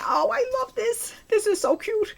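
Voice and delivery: gushing. Real, not acted. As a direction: gush like you just saw a puppy. Gushing over a cute craft.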